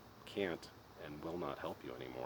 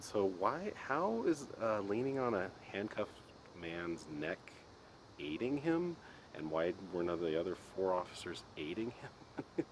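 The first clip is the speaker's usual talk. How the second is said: incredulous retort; 4 semitones higher, slower than usual. Retold or imitated. Retorting to his dad.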